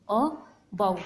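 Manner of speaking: "about" in two separated beats, a - bout; syllable by syllable. Shown as a demonstration. Teacher counts two vowel sounds for students, "about" broken into syllables. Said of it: "two times".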